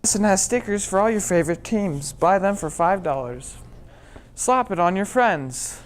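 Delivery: loud, bouncy sticker commercial; chipper 1950s ad. Acted. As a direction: sell it like an eager ad announcer. Kids' commercial read.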